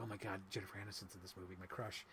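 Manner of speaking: low, quick and very soft; under the breath, low and hurried; hushed inner thought. Retold or imitated. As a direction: murmur it as a private thought. Retelling a remembered private thought.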